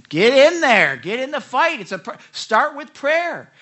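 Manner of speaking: loud, high rallying call; urgent exhortation. Acted. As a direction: urge them into battle at full voice. Voices God urging people to fight.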